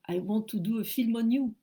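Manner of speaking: put-on English with a heavy French accent. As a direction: say it like a shy Frenchwoman. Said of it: "avec cet accent-là à peu près". (anglais avec un fort accent français, un peu hésitant)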